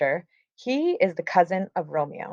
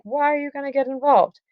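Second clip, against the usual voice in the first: higher-pitched mock protest; exasperated questioning; 8 semitones higher than usual. Lady Capulet asking her husband why he'd fight, high-pitched protest. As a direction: pitch it up as a puzzled objection.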